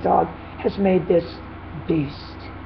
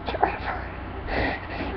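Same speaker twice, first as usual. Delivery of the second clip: whispered; 12 dB quieter, much slower than usual. Acted. Mock line in acting joke.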